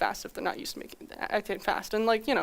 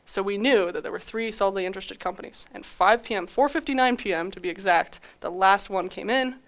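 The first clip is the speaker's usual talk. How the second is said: normal talking voice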